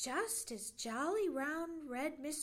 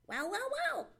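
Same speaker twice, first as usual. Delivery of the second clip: high, loud, sharp squirrel voice; 4 semitones higher, 5 dB louder than usual. Acted. Red squirrel surprising Johnny in the log, sharp cry. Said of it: "cried a sharp voice".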